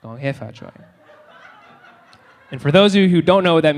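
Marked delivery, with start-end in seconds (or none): 0.0-0.7 low, hushed mock incantation; quiet, ominous chant played for laughs; spell-casting. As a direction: intone it softly like a curse. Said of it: "she's casting spells".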